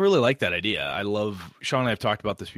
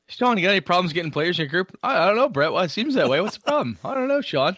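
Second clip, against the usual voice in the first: higher, playful voices trading jabs; teasing mock dialogue; 8 semitones higher, 3 dB louder than usual. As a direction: ham up a teasing exchange. Mock back-and-forth teasing.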